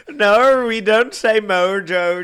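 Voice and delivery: loud, high, slow Aussie denial; Australian accent. Retold or imitated. Mocking Australians denying the accent, loud and slow. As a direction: stretch it out in a thick Aussie voice. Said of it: "do Australian people say Mojo Jojo".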